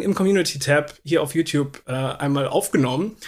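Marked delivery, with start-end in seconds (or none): none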